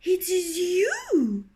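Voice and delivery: very high, hen-like fretful voice; frightened wife telling her husband he is the target, clucking. Acted. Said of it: "clucked Partlet".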